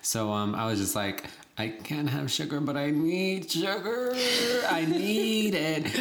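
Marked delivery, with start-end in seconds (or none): 1.6-5.9 mock-desperate, high-pitched wail; hammed-up sugar withdrawal; desperate craving. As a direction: draw out the craving in a pleading whine.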